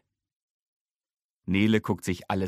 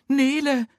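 a sudden high, loud call of alarm; Mama jolts awake in fright; startled cry; 6 dB louder than usual. A narrator's reading. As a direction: erschrocken. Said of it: "sagt sie ganz erschrocken".

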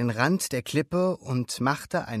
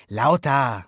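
louder, brief order; commanding; 6 dB louder than usual. A narrator's reading. Alberto coaches timid Luca, commanding. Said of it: "befahl Alberto".

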